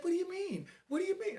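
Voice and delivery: very high falsetto protest; no-bass effeminate voice. Retold or imitated. Young inmate questioning the porter's objection, high and effeminate. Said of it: "Let me hear some bass in your voice".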